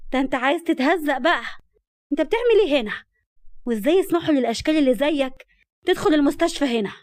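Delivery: high, loud indignant outburst; exasperated anger. A narrator's reading. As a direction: lash out at an unwelcome man. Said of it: "وقالت بغيظ".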